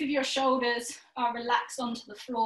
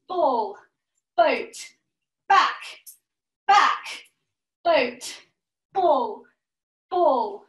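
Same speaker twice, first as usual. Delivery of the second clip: louder, punchy single-word calls; calling out poses; 4 dB louder, much slower than usual. Shown as a demonstration. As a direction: bark each pose like a game call. Calls poses for the exercise game.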